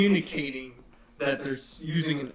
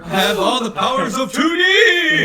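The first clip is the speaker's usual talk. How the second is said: high, full-voiced shout; mock battle cry; 9 semitones higher, 12 dB louder than usual. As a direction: yell it like a cartoon superpower.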